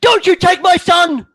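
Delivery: high, loud desperate shout; mother screaming at the soldier taking her son away, high and loud; furious mother. Acted.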